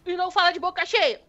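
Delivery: high scolding motherly voice; gruff mother. Acted. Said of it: "ela pega e dá um pedala nele".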